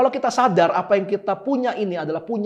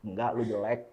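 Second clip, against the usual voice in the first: mock devil sneer; 7 semitones lower, 8 dB quieter than usual. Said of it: "Koko pura-pura jadi iblis".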